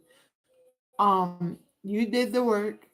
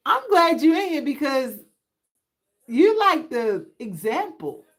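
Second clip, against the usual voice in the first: excited; 6 semitones higher, 6 dB louder than usual. Real, not acted. Excited aside to a viewer.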